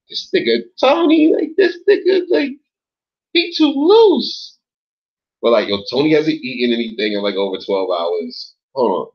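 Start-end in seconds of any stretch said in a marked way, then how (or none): 0.1-4.6 very high squeaky falsetto mimicry; mock onlooker. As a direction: tease yourself in a much higher put-on voice. Mimics others commenting on him.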